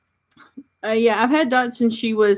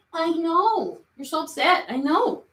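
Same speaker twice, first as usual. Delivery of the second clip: cooing to the cat; 7 semitones higher, 6 dB quieter than usual. Real, not acted. Owner comforting her upset cat, high soft coo. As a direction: soothe the upset kitten gently.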